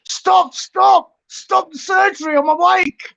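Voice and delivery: high-pitched mock panic shout; comic high yell of alarm; retold alarmed cry. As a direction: act out crying out in alarm on waking. Retold panicked waking in recovery.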